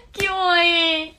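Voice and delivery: put-on slow, sweet anime girl voice; high cute anime girl. Shown as a demonstration. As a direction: drawl it out like a cute anime heroine. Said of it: "アニメ声にできるよ".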